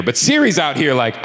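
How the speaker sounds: usual speaking voice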